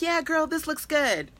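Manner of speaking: high-pitched imitation of an impressed follower; mock approval. Teacher imitating a follower who likes it, mock approval. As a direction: put on a high, breezy girlfriend voice.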